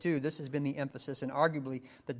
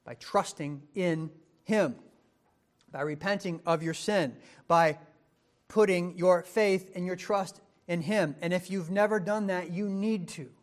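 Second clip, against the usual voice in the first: louder, higher, pressing appeal; preaching at full force; 4 semitones higher, 6 dB louder than usual. Acted. Preacher urging listeners to trust Christ, rising to full force. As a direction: drive the call home from the pulpit.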